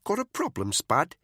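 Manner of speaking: woman's voice, needling a teammate; Caitlin, challenging. A narrator's reading.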